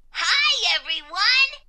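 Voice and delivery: high, loud burst of hello; exuberant greeting. A narrator's reading. Exclamation Point bursting into school, exuberant greeting. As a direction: burst in and greet the whole room. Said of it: "Exclamation Point burst forward".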